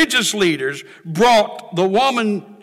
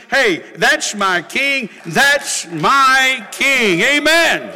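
loud, high, drawn-out cry of praise; exultant preaching shout; 7 semitones higher, 5 dB louder, slower than usual. Real, not acted. Preacher crowning his praise of the king, exultant shout. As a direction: shout it out, stretching each word.

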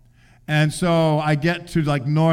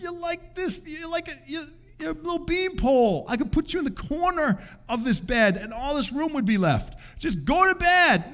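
pleading dad; 13 semitones higher than usual. Retold or imitated. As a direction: plead with the overtired daughter. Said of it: "I'm like".

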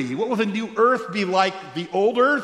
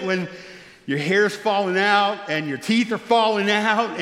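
ordinary delivery

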